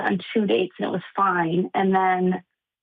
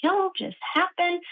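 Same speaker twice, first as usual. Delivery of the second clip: higher, slow, stretched-out disbelief; retold stunned bewilderment; 6 semitones higher, slower than usual. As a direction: replay being dumbfounded, drawing out the words. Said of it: "mouth agape, looking around, going".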